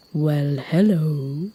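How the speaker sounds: low, very slow creature voice; drawn-out deep greeting; big talking rock. Acted. Rock greeting a little giraffe, deep and very slow.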